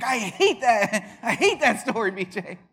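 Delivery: loud, high wail of protest with laughter; mock exasperated mom. Mom scolding her son about the story, mock exasperation.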